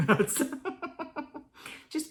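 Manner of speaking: helpless high laugh; high, breaking-up laughter. Real, not acted. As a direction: break into giggles mid-sentence. Breaks into laughter mid sentence.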